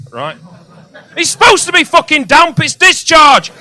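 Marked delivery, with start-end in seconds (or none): 1.2-3.5 much higher and louder, indignant yelling; outraged rant. Real, not acted. Comic shouting at a doctor's note, outraged and loud. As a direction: blow up at the stupid note.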